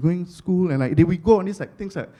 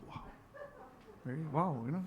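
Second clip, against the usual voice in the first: ordinary talk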